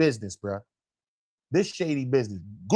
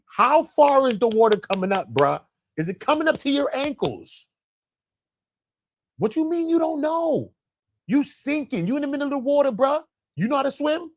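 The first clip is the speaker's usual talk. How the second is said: incredulous questioning; 8 semitones higher than usual. Pretend. Mock-questioning the caller.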